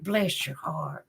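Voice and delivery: slow, hushed and sweet; tender whispered blessing; soft sweet blessing. Shown as a demonstration. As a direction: bless them gently under your breath. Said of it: "I say that all the time".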